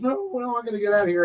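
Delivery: high, squeaky mock panic; panicked grasshopper. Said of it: "So he goes".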